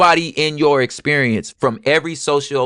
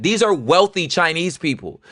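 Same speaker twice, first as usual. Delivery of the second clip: ordinary talk, nothing marked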